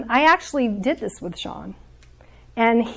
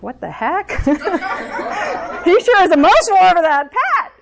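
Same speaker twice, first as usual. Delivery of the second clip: put-on high, louder teasing kid-brother voice with a laugh; mocking brother; 8 semitones higher, 4 dB louder than usual. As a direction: jab at the crying sibling, laughing. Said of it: "his other brother's like".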